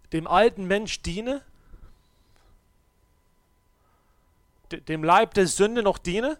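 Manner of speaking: preaching at full force. Acted. Preacher confronting listeners about divided lives, loud.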